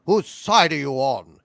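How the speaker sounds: loud British demand, as the prisoner; forceful TV-drama British accent. Acted. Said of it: "more of an actual British accent".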